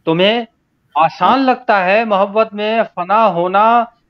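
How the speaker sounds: emphatic unsung ghazal recital; spoken verse recitation. Acted. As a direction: declaim the couplet with rising, lingering phrase ends. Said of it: "मैं तहत में ही पढ़ता हूँ".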